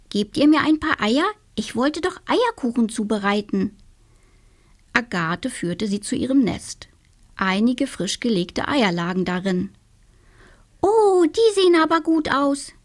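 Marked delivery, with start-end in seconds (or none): 0.1-3.8 higher little witch voice, a polite request; the witch asking the hens for eggs. Acted. 10.8-12.7 high, pleased little witch voice; the witch admiring fresh eggs; delighted little witch. Acted.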